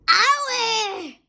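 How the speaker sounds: high, startled yelp of pain. A narrator's reading. Crocodile yelping as he tumbles over. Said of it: "cried the crocodile".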